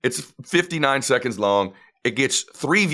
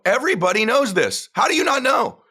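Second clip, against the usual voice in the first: high-pitched, exasperated know-it-all; mock scolding; 10 semitones higher than usual. Dad scolds son over his tanked video, exasperated. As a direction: scold with comic certainty, climbing in pitch.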